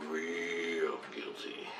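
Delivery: slow, low, very soft; heavy guilt. Real, not acted. Storyteller recalling the guilt that hit him, slow and hushed. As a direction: draw it out under the weight of guilt.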